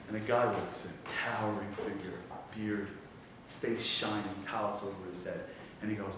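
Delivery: low, very soft narration with long pauses; hushed suspense storytelling. Acted. Preacher narrates Satan's victory slipping away, hushed suspense. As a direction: draw them in quietly, pause between phrases.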